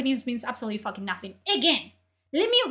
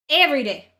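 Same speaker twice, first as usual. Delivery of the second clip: louder, half-shouted Every day; exasperated outburst; 6 dB louder, faster than usual. Real, not acted. Ranting streamer exasperated at people, loud outburst. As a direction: blurt Every day in disbelief.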